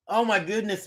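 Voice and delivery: usual speaking voice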